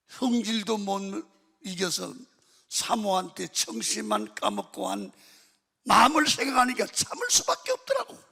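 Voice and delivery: loud, building preacher's delivery; impassioned preaching climax. Real, not acted. Pastor confessing why he held his temper, impassioned preaching. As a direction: build to a loud, high-pitched peak.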